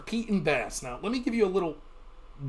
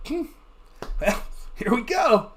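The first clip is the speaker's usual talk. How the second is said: unbothered shrug; 5 semitones higher than usual. Retold or imitated. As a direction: take it easy, mildly amused. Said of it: "I wouldn't be this animated".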